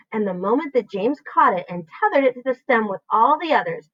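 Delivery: ordinary delivery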